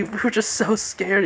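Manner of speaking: high-pitched mock scared girls. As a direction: lightly act out frightened girls, pitched high. Voices two girls who were scared.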